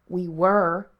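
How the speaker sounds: put-on mutinous kid, understated; sullen. A narrator's reading. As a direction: answer back sullenly. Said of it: "said Mikey sullenly".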